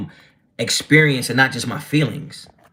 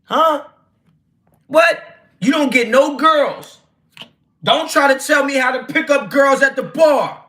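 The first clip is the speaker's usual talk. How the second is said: incredulous loud outburst; 10 semitones higher, 4 dB louder than usual. Real, not acted. Scoffing at a chat comment.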